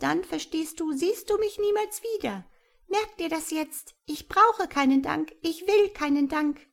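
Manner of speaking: high, firm, warning creature voice; stern creature. A narrator's reading. Creature forbidding Tom ever to thank it, high and stern. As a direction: warn him sharply, tiny and fierce.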